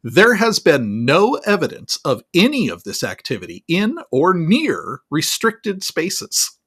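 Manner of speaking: imitated emphatic denial, swooping on key words; Kirby. Spokesman doubling down to a reporter, mock-emphatic. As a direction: double down, stretching out each stressed word. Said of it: "He doubles down".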